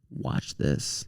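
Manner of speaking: whispered aside to his friends. Retold or imitated. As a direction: hush it conspiratorially. Showing off to friends.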